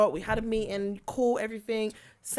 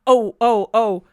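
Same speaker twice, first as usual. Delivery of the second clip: loud excited exclaiming; 10 dB louder than usual. Real, not acted. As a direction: cry out in loud disbelief. Reacting to being called out.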